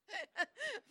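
high giggle through the words; real giggle, pitched high; still laughing. Real, not acted. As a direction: keep laughing at the impression. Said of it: "still laughing".

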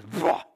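loud, sudden explosive whoosh; imitated gas flare-up. Man telling how the gas barked at him, loud imitated flare-up. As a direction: burst out like flame leaping at you.

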